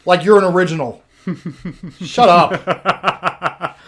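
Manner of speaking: drugged patient mocking the pregnant neighbor, shouting; loud outburst. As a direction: sneer and shout, fully unhinged. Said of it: "the outburst".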